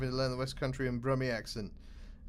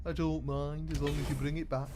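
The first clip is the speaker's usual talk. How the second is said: put-on Brummie accent; 2 semitones higher than usual. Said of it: "that's a Birmingham accent for you".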